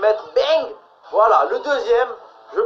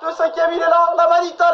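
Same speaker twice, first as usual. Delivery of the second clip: high, fast, rising goal cheer; excited goal call; 10 semitones higher, 4 dB louder than usual. Real, not acted. Fifth goal going in.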